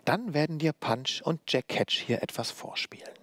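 high-starting, falling whispery lilt; strange singsong. A narrator's reading. Old puppeteer announces her show to the boy, eerie singsong. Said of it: "in ihrem merkwürdigen Singsang".

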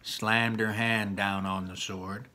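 usual speaking voice

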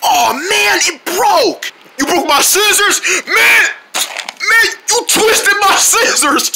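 high, whining mock outrage; upset complaint. Owner to whoever broke the scissors, high-pitched dismay. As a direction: wail over a ruined prized possession.

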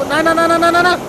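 high, loud rapid-fire panic; frantic repeated yelling; panicked cry. Real, not acted. Panics as the game goes wrong.

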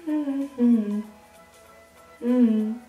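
ordinary talk, nothing marked

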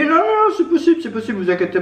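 squeaky, eager reassuring voice; high-pitched cashier voice. Retold or imitated. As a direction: chirp it high and bright. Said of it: "Elle m'a dit".

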